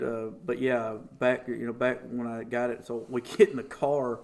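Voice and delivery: normal talking voice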